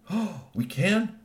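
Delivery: high, drawn-out delighted question; thrilled disbelief; ecstatic surprise. Acted. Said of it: "He was ecstatic".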